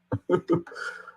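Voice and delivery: short airy laugh; breathy laugh. Real, not acted. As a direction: let a quick breathless laugh slip. Laugh inside the mock.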